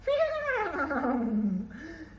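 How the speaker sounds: long braying laugh, trailing down; drawn-out hee-haw cackle; donkey laugh. A narrator's reading. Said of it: "o burro deu aquela risada".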